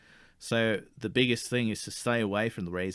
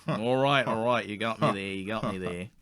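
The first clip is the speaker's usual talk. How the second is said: ordinary talk, nothing marked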